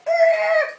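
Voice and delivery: high, loud mock yelp of fright; startled yell. Dad jolting the jeepney to a stop, high startled yell. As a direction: yelp as if a dog jumped out.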